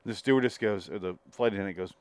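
ordinary talk

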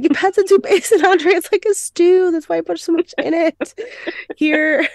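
half-laughing fake sob; mock crying. [name] defending his soup as an entree, a hammed-up high tearful wail. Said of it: "He's crying".

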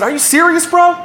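high-pitched disbelieving question; incredulous. Retold or imitated. Kid with gun questions his brother, incredulous. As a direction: ask it high, in retold disbelief.

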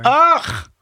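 loud high groan of defeat; a real exasperated ugh; frustrated ugh. Real, not acted. Reader giving up on Kindle, loud ugh. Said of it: "I'm done".

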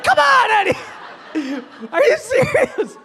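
high-pitched, shouted disbelief; hammed-up yelp of betrayal; outraged protest. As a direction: squawk it in comic indignation.

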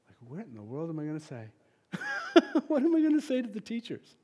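high laughing what-do-I-say; laughing panic. Real, not acted. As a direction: laugh at your own dilemma.

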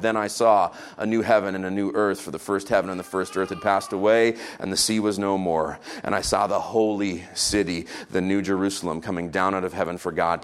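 low, hushed reverent reading; solemn scripture reading. Acted. Preacher reading Revelation to the church, hushed and low.

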